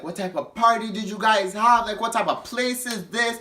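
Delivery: hammed-up scolding customer; angry renters. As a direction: imitate the scolding drunk renters. Retelling angry drunk renters.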